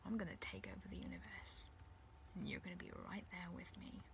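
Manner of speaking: soft, half-whispered villainous menace; hushed, sinister promise; quiet gloating. Acted. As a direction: gloat softly, almost under the breath. Villain gloats close to captive.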